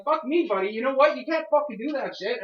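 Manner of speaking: loud and high, jabbing at him; retold furious shout. Tenant reliving shouting at the security guard, furious and loud. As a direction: blast him with it, full anger. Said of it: "You heard how angry I get".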